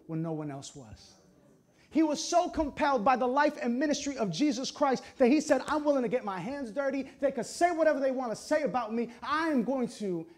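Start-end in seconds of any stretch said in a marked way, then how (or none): 1.9-10.2 high, loud, emphatic preaching; raised pulpit voice, pressing hard; preaching at full force. Real, not acted. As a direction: drive the point home to the congregation.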